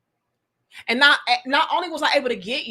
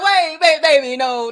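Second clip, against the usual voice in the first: panicked pleading; 4 semitones higher, 7 dB louder than usual. Retold or imitated. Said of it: "he was like".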